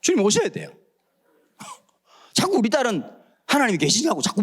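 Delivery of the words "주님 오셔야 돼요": usual speaking voice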